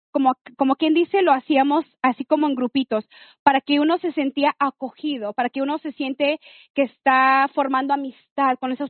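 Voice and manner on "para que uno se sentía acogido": ordinary talk, nothing marked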